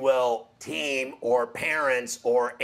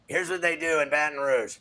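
ordinary talk, nothing marked

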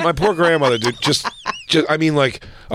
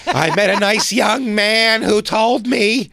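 put-on higher, slow old lady voice; 7 semitones higher, slower than usual. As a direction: play a fussy old woman.

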